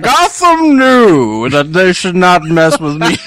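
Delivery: hammed-up Bane threat. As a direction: menace Gotham in mock Bane voice. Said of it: "like Bane has to".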